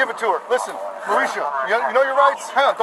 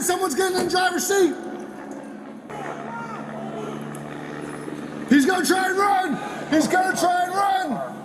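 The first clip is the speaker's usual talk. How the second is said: urgent high shouted police orders; shouting commands; 10 semitones higher, 4 dB quieter than usual. Real, not acted. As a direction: yell the commands at the suspects. Police stop, officers yelling commands.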